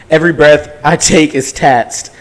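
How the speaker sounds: ordinary talk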